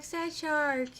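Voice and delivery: a lightly voiced pitiful character; mock sad shark. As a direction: say it like a sorry little shark. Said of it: "Be like".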